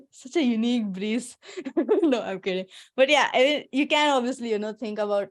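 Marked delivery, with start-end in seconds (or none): 1.5-2.0 short laugh. Real, not acted. Laughing at her own joke.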